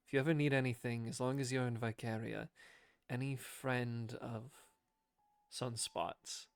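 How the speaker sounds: soft, halting, resigned offer of help; sighing reassurance. Acted. Kel offers help to a friend's companion, sighing and soft. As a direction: let it out softly after a sigh, pausing between phrases. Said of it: "He just sighs in and says".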